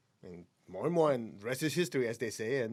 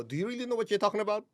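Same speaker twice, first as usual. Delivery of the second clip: quick, higher, mock-sceptical challenge; challenging retort; 7 semitones higher, faster than usual. Retelling how he answers people who quote energy, mock-sceptical. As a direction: fire it back like a playful dare. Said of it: "I go".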